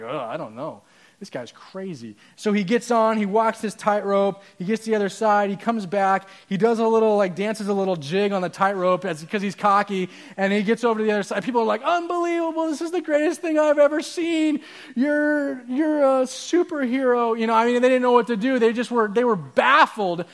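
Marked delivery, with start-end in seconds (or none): 0.0-2.2 doubtful onlookers. Acted. Crowd voiced as doubtful. 11.8-17.4 high-pitched, gushing onlookers; mock-ecstatic crowd, pitched way up; amazed crowd. Amazed crowd voiced in story.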